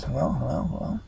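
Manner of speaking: imitated echo, fading away. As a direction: repeat it as a dying echo. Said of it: "His voice echoes".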